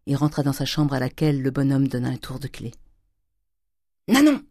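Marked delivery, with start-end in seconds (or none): 4.1-4.4 high, loud call across the house; shouted call. Acted. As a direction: bellow the servant's name. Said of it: "cria-t-il". (appel crié)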